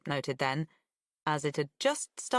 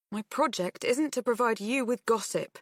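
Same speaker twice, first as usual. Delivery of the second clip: suppressed annoyance; 6 semitones higher than usual. Acted. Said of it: "tried to flatten out her voice when it spiked with annoyance".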